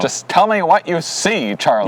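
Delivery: mock radio operator. Playful radio order quote.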